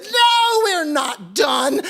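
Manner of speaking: very high, loud cry of "No"; piercing protest, slowing at the end; defiant shout. A narrator's reading. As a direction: yell back, refusing to quit. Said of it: "jumped up and said".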